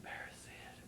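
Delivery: unvoiced whispered aside. A narrator's reading. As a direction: whisper it, almost silent. Whispered narration tag.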